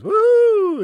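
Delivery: loud, high cheer of delight; excited whoop. Real, not acted. Host cheering a viewer who finally caught the live, loud high whoop. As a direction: whoop it out, thrilled.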